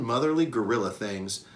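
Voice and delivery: plain reading voice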